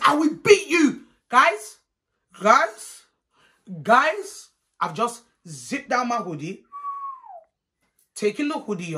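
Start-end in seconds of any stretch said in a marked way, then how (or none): 0.0-1.0 high, loud, drawn-out threat; mock auntie threat, stretched and pitched up; threatening auntie. Auntie getting in the girl's face, loud high threat. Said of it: "auntie's coming in my face". 1.3-4.5 loud, rising call; each word pitched up sharply like a plea for attention; incredulous appeal. Real, not acted. Storyteller bracing listeners for what came next, loud rising appeal.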